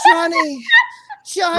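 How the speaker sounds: high, loud hammed-up cry of a name; mock scream. Streamer acting out the statement, mock scream. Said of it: "I was screaming".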